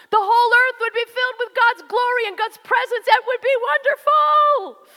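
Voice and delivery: rising excitement. Real, not acted. Preacher building to climax.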